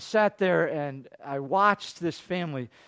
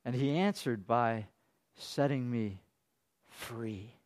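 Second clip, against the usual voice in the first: quiet psalm reading; 8 semitones lower, 9 dB quieter than usual. Retold or imitated.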